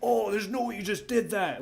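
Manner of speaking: high, outraged mock tantrum; dancing angry. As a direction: imitate someone hopping with indignation. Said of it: "angry, like".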